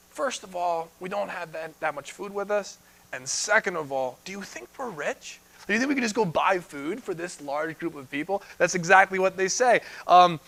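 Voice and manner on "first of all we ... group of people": hushed disbelieving disciples, a hint of pleading; softly object, as if it's absurd; quiet mock protest. Disciples answering Jesus, voiced.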